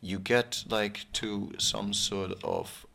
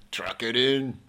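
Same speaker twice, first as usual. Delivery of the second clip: put-on Australian accent; 2 semitones higher, 5 dB louder than usual. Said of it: "that's Australia, mate".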